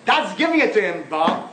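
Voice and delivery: hint of a thick-accented caller; mock caller. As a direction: cheer the host on like a fan. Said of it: "this fellow calls it, and he says".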